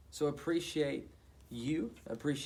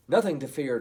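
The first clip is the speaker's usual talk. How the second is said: louder, firm declaration; confident declaration; 10 dB louder than usual. Acted. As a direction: declare it boldly. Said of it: "Toot declared".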